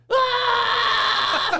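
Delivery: very high shout through the ceiling; yell. Retold or imitated. Shouting over the loud amp, high and loud. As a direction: let out a wild shout. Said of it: "You just hear me going".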